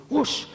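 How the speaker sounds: hoog nagedaan vuurgeluid; whoosh of flames. Acted. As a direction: doe het opflakkerende vuur na. Match ignites cognac.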